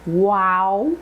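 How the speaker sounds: "Wow" with a wide opening jaw glide; teacher exaggerating the ow sound; big jaw movement. Shown as a demonstration. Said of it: "Really work the jaw".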